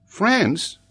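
high-pitched, unflustered pupil; unruffled schoolboy; composed child. Acted. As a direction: answer calmly in a high child's voice. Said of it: "said Tolini quietly".

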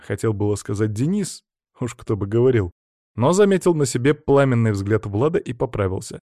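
plain reading voice

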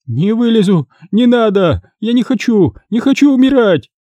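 very high, rising lament; panicked wailing. A narrator's reading. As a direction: refuse and wail, nearly fainting with fear. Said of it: "Почти теряя сознание, запричитала Клыкова".

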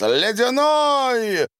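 drawn-out, very high loud yell; shouted demand. A narrator's reading. Savva demands cold water from the attendant, shouting.

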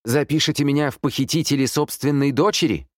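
high-pitched, outraged question; indignant retort. A narrator's reading. Accused mother answers the sergeant by phone, indignant. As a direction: throw it back at the caller, indignant.

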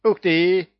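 high, loud parrot cry of joy; overjoyed parrot voice; delighted exclamation. A narrator's reading. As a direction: squawk it out happily. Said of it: "обрадовался попугай".

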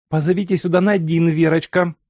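put-on a bossy guest ordering the girl around; commanding. A narrator's reading. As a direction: give the order briskly like a hostess in charge. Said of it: "скомандовала Хиония Алексеевна".

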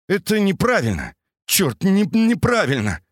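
on the verge of breakdown. A narrator's reading. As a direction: near tears, losing it. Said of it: "Серёга был на грани срыва".

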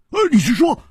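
high, stammering disbelief; flustered, rapid and incredulous; cutting in urgently. Acted. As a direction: blurt out the questions, tripping over words. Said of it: "就抢着说".

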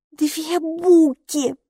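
high, slow, drawn-out announcement; child's announcement. A narrator's reading. Hilla reports who is at the door, high slow announcement. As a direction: announce it slowly with pitch held up. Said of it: "объявила она".

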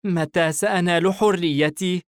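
put-on high-pitched boy complaining to himself; resentful self-talk. A narrator's reading. Son grumbling to himself about freedom, displeased. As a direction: lament audibly as the put-upon son. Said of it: "باستياء وبصوت مسموع لنفسه".

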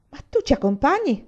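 alarmed cry of disbelief. A narrator's reading. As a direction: blurt it out in panic. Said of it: "gridò".

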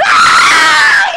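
very high, loud unvoiced shriek; group scream. Friends shriek at the hostess, very high loud scream. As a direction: shriek in horror.

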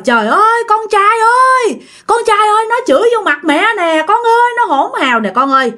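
acted hysterical tattling, very high pitch; raging mother-in-law. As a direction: call out to your son in loud indignant complaint. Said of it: "bà mẹ chồng bắt đầu lồng lộng lên".

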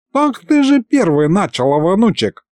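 put-on high-pitched old man's protest; indignant. A narrator's reading. Grandfather throws blame back at grandson, indignant. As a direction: protest as if affronted. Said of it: "возмутился дед".

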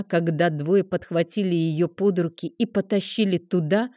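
ordinary delivery, nothing marked

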